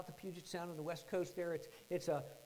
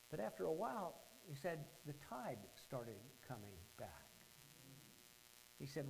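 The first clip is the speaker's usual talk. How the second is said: normal talking voice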